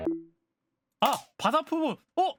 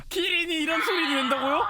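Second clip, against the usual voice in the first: laughing disbelief; 5 semitones higher, 3 dB louder than usual. Real, not acted.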